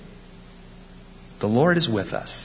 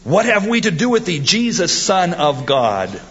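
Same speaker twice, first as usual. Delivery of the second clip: high, loud demon cry, slowing at the end; 7 semitones higher, 3 dB louder than usual. Acted. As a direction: scream it as a demon, then drag out the last words. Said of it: "these demons had".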